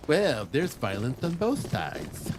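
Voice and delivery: slow, put-on fence-sitter voice, mocking; mock moderate voice. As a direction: drag it out like a smug centrist dodging blame. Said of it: "claim they wanna, they take the, quote, "moderate position".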